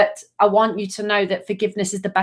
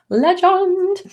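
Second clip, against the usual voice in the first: high, bright burst of praise; excited praise; 11 semitones higher than usual. Real, not acted. Host hailing the astrologer in the comments, excited. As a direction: cheer the word out.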